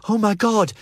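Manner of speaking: almost screaming in terror; near scream. Acted. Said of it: "Jell almost screams".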